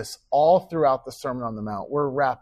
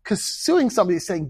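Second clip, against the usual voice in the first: usual speaking voice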